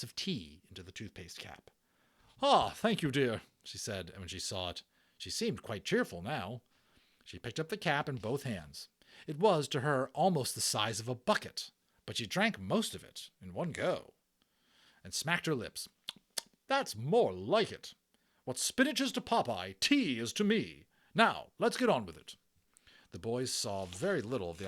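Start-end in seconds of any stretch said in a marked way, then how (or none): 2.4-3.4 cheerful thanks. Acted. Matron grateful for tea. 16.7-22.4 cheerful matron. Acted. Matron refreshed by tea.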